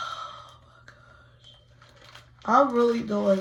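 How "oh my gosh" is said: breathless slow whisper; awed whisper. Real, not acted. Whispering to herself as she braces to rip. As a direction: barely breathe the words. Bracing before ripping wax.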